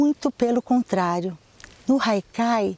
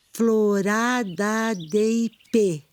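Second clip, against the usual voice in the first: "Florada de ipê" said slowly, syllable by syllable; slow scansion of the verse; counting syllables; much slower than usual. Shown as a demonstration. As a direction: spell out the syllables of "ipê" unhurried. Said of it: "Cinco sílabas".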